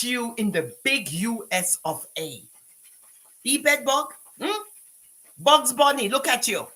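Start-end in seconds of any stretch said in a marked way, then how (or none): 3.4-6.7 mocking taunt. Real, not acted. Mocking the woman.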